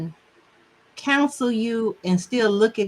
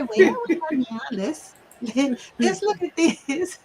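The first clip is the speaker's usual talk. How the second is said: high sing-out "well, well", breaking into giggles; imitating her, pitched up and amused; high-pitched coworker voice; 6 semitones higher, slower than usual. Coworker slowing down a complaining friend, high-pitched imitation. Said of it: "she had this high-pitched voice".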